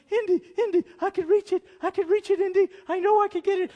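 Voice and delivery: hammed-up Elsa grasping for the grail; Elsa straining. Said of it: "And she goes".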